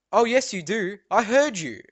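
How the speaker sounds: scolding teacher, hammed up; mock stern teacher. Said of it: "And she's like".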